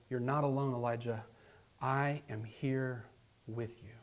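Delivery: whisper-close comfort to someone alone; still small voice. Acted. God reassuring lonely Elijah, soft and slow. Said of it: "in a still small voice and calls him by name".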